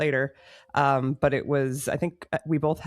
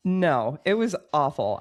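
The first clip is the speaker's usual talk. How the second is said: usual speaking voice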